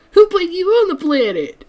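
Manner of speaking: very high, loud cartoon squeal; imitating SpongeBob. SpongeBob shouting back at Squidward, in a high cartoon squeal. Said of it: "And SpongeBob goes like".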